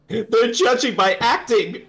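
high, slow mock wail; hammed-up tearful complaint; mock whining character. Improvises whining film character's complaints.

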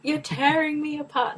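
high, anguished mock outburst; hammed-up cry of betrayal; melodramatic film quote. Acts out the famous film quote.

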